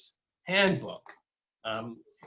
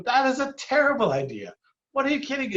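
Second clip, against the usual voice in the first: outraged; 12 semitones higher, 3 dB louder than usual. Retold or imitated. Retold outraged reaction to idea.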